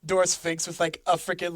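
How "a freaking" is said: ordinary talk, nothing marked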